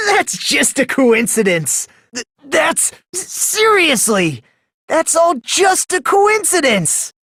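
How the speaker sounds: high, stammering denial; stuttered high excuses, slowing at the end; flustered denial. Acted. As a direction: deny it while falling apart. Flustered denial after accusation.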